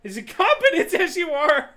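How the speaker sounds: loud, high insult breaking into a laugh; loud amused jeer; hammed-up taunt. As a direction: shout the taunt, barely holding back laughter. Acted taunt in game dialogue.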